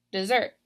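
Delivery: "Dessert" said duh-ZERT in one go; stress on second syllable. Shown as a demonstration. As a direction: punch the last syllable of "Dessert". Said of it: "I'm stressing that second syllable".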